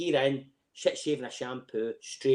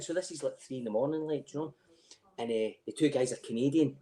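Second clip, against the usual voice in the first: ordinary delivery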